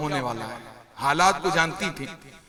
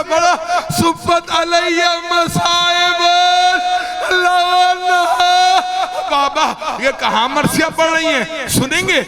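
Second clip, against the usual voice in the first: high, sustained mourning oration; impassioned lament preaching; 11 semitones higher, 7 dB louder than usual. Acted. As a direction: lament to the congregation at full force.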